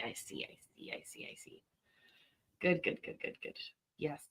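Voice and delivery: barely voiced whisper; whispered realisation. Real, not acted. Streamer grasping that dogs were playing, whispered. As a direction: whisper it under your breath, repeating quickly to yourself. Realisation, muttered to self under breath.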